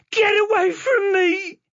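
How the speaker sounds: high, loud sobbing scream; girl grabbed by a stranger, screaming; crying scream. Acted. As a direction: scream through tears. Chloe grabbed by the shoulders.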